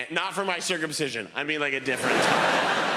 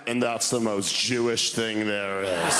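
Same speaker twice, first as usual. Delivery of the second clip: put-on low, slow deadpan old-man voice; gruff deadpan elder; dry grandfather; 7 semitones lower than usual. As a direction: drop it flatly like a wry grandfather. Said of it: "just went".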